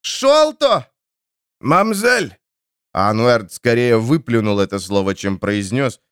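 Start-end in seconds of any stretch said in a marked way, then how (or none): 0.0-0.9 high desperate call; shouting a name. Acted. Calling out in water. 1.6-2.4 spluttered shout. Acted. Spluttering reply from water.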